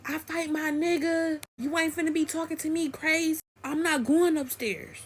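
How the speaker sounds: high, sustained defiant quote; high-pitched stubborn mock rant; retold defiance. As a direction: imitate the friend refusing to back down. Friend defiantly refuses, retold quarrel.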